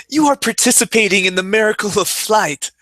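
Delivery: comedian quote. Retold or imitated. Quoting Louis C K bit.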